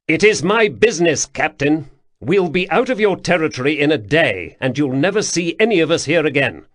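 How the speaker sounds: commanding high voice, clipped authority; a young prince pulling rank on a guard captain; imperious prince. Acted. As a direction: loom over him as the prince. Prince dismisses captain's concern.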